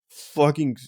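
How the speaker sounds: ordinary talk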